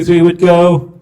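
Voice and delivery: ordinary delivery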